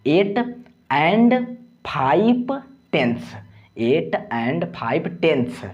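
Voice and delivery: slow, word-by-word teaching demo; formal decimal reading. Shown as a demonstration. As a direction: separate "eight", "and", "five टेंथ्स" with pauses. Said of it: "formal तरीके से कैसे पढ़ेंगे". (धीरे, एक-एक शब्द अलग करके)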